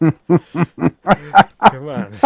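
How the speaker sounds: laughing, loud and high. Real, not acted. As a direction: blurt it out through laughter.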